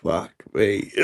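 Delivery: ordinary talk, nothing marked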